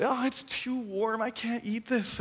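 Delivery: put-on high, drawn-out toddler whine; whining child. Three-year-old rejecting a second bite, high slow whine. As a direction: imitate a little kid wailing in complaint, slowly.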